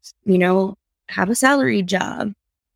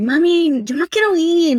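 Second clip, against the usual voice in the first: put-on high, louder childish protest; whiny kid; 8 semitones higher, 4 dB louder than usual. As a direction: whine like a kid who doesn't want to go. Child refusing mom's errand.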